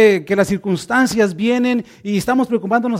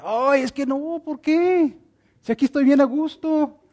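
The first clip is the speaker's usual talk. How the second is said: higher, complaining reluctant voice; whiny believer; 5 semitones higher than usual. Pretend. Believer whining back at God, not wanting to move. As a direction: whine like someone who won't budge.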